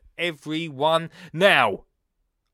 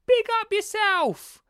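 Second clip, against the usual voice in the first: hyped shout; 4 dB louder, slower than usual. Pretend.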